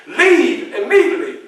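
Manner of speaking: high, loud command with a laugh in it; urgent order. Retold or imitated. Garrison ordering Frederick to drop his speeches: high loud urgency. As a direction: push him out the door right now. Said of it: "He said".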